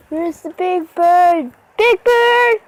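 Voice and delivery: calling out. Real, not acted. Calling for the eagle.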